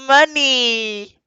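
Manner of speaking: high, stretched-out cry; hammed-up high wail of money; mock drunk cry of money. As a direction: wail the word like a drunk show-off. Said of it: "motherfucker was like".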